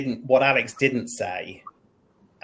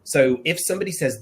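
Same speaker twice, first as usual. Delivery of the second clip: ordinary delivery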